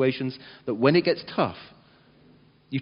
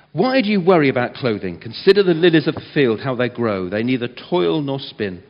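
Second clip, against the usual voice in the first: louder, measured Bible reading; scripture reading; 2 semitones lower, 8 dB louder than usual. Acted. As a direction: read the passage out to the congregation. Reads scripture aloud.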